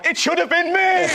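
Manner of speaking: high, loud, slow anguished cry; bitter lament. Retold or imitated. Buzz Aldrin at the mirror, loud high slow anguished wail. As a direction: wail it like a man robbed of glory. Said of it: "looked in the mirror and gone".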